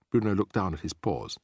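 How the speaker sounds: ordinary delivery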